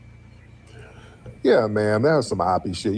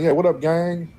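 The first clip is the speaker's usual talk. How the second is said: slightly raised Chicago street greeting; Chicago slang demo; 4 semitones higher than usual. Shown as a demonstration. Host showing real Chicago talk, Chicago slang greeting. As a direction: show how Chicago slang sounds. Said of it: "Yeah, Chicago slang".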